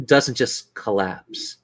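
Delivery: slow, soft dramatic scene setting; game master's eerie narration; ominous narration. Acted. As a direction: draw out the reveal darkly.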